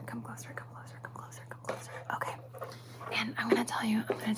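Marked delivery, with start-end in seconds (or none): 0.0-2.3 barely audible hushed whisper; whispering a secret. Acted. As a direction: breathe it right into their ear. Drawing listener in for secret.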